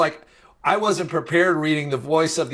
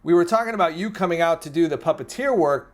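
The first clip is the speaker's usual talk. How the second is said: ordinary talk, nothing marked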